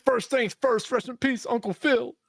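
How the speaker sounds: high mocking voice of a know-it-all fan; high-pitched mocking tribute; mock reverent quote. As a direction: parody a smug fan paying tribute. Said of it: "they'll be like".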